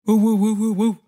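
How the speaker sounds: quick run of fan woofs; dog-bark woofs. Shown as a demonstration.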